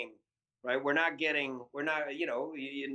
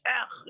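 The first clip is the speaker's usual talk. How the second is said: higher, mock exasperated "ugh"; imitated disgusted grunt; 11 semitones higher, 4 dB louder than usual. Guest imitating stranger throwing hands off, exasperated grunt. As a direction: give up with a throaty scoff.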